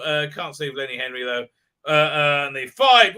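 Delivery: normal talking voice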